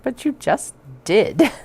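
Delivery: high, loud comeback to the fabric; playful mock argument with the bag; exasperated retort. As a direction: talk back at the fabric, fed up.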